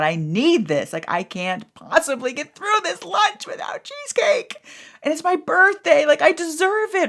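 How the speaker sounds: high pleading whine; self-justifying whine. Retold or imitated. Telling herself she deserves birthday cheesecake, high whiny plea. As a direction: talk yourself into the cheesecake.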